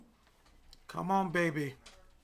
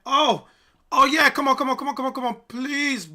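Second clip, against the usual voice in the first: loud, high, thrilled yelling; excited shouting; 10 semitones higher, 14 dB louder than usual. Real, not acted. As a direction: cheer the trade on at full voice. Trade suddenly jumps his way.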